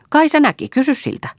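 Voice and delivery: very high, shrill taunting voice; mocking cackle. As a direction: taunt him, bursting into laughter. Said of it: "nauruun rämähtäen".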